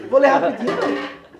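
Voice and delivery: loud, playful correction with a laugh; joking self-correction. Real, not acted. Teacher correcting himself before reading, loud and joking. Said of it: "Vou ler rápido, vou ler na velocidade".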